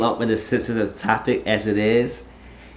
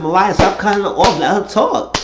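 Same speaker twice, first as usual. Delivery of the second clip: higher, louder exasperated command; retold telling-off; 9 semitones higher, 5 dB louder than usual. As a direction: step in firmly to defend a friend.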